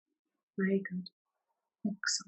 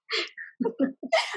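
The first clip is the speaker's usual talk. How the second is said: short high laugh; 9 semitones higher, 5 dB quieter than usual. Real, not acted. Laughs at own slip.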